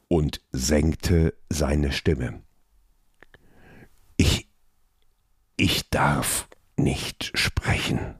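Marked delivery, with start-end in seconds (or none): 4.2-8.1 hushed, slow hissing whisper; quiet hiss. A narrator's reading. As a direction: hiss it softly, afraid to be heard. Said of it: "zischte er leise".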